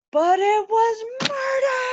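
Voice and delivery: high, loud anguished cry; dramatic ghost cry. Acted. Ghost revealing his murder to Hamlet, loud anguished cry. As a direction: wail the reveal.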